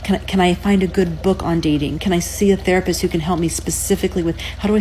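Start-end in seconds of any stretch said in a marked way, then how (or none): none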